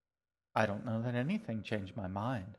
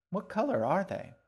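put-on higher, eager young girl; curious girl; 9 semitones higher, 5 dB louder than usual. A narrator's reading. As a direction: ask it like an eager child. G asks eagerly.

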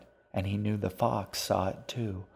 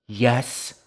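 a sharp, loud, higher yes; loud demand; 4 semitones higher, 8 dB louder than usual. Acted. G demands the fox's answer, loud and higher. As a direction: push the question into its face. G shoves her face forward.

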